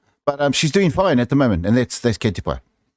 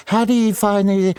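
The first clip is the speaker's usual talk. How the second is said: interviewer voice; 9 semitones higher than usual. Retold or imitated. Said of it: "You want to go and do that".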